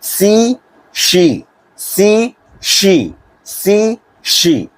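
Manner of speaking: सी with a thin s sound, शी with a broad sh sound; sea versus she drill. Shown as a demonstration. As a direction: contrast सी and शी slowly for the class. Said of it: "इसमें H है तो श आ जाएगा".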